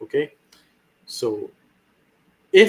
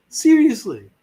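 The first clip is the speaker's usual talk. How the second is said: ordinary delivery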